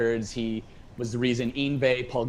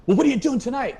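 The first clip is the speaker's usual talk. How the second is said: high, louder imitation of an eager friend; mock eager invitation; 12 semitones higher, 6 dB louder, faster than usual. Label boss inviting a caller out, imitated high and eager. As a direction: pitch it up, keen to meet.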